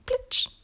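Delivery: high-pitched "plitsch" sound effect; short, very high wet slap; imitated splat. A narrator's reading. Frog hopping up the stairs, high splashy imitation.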